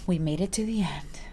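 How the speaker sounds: soft playful whisper. Real, not acted. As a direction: whisper it as a little secret. Playful aside to viewers.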